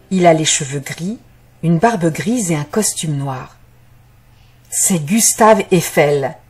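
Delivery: ordinary delivery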